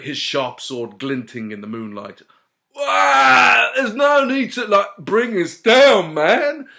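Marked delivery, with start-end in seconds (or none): 2.8-6.7 high, loud whiny protest; bummed-out dude crying out; upset hippie fairy. A narrator's reading. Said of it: "the fairy replied".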